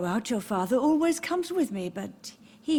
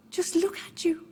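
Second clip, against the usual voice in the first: whispered; 7 semitones higher than usual. Acted. Mother pities her son's pallor.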